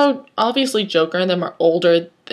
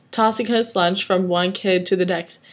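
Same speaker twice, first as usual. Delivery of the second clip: ordinary delivery, nothing marked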